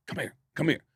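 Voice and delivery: soft, hushed beckoning; quiet, repeated imitation of a manager's summons; discreet summons. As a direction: call someone over without disturbing the show. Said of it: "goes like this to Chris".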